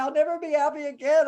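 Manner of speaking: high, loud mock lament; hammed-up wail of hopelessness; dramatic despair. Grieving spouse likened to a child, dramatic wailing. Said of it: "more dramatic".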